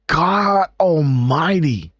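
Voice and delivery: ordinary talk, nothing marked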